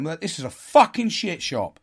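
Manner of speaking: loud, annoyed grumbling that cuts off mid-word; angry venting. Retold or imitated. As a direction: vent at the whole shop. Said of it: "I was venting".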